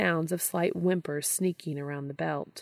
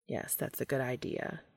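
low flat Castiel voice; 5 semitones lower, 8 dB quieter than usual. A narrator's reading.

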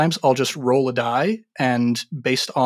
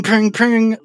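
imitated hammer pings; 9 semitones higher, 8 dB louder than usual.

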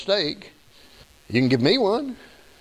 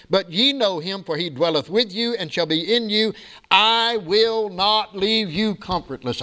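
higher-pitched, steady scripture recitation; preaching; 6 semitones higher than usual. Real, not acted. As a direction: proclaim the verse to the congregation.

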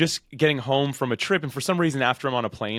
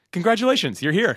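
excited; 8 semitones higher, 4 dB louder than usual. Real, not acted. Congratulating host on milestone.

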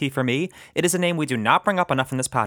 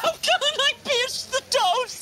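high, slow, theatrical panicked wail; hammed-up diva meltdown over toast; Nathan Lane freakout; slower than usual.

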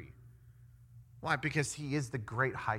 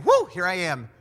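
loud, raised whoop, then a proud declaration; exuberant announcement; 2 semitones higher, 10 dB louder than usual. Acted. Jesus stands up to announce himself, exuberant. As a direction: call it out to be noticed.

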